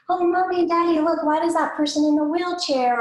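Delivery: lightly voiced little kid asking parents; curious young child. Child pointing out a difference to parents, curious. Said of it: "when they're really young".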